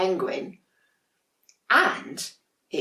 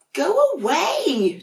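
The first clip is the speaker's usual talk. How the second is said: high, slow, cross little penguin voice; grumpy penguin; 6 dB louder, slower than usual. Acted. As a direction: push the siblings away, sulky and high. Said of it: "Dudley would say".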